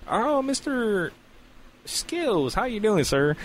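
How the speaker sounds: high, drawn-out mock welcome; doctor's hearty greeting. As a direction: greet a patient with a stretched, high "oh". Acts the doctor greeting him.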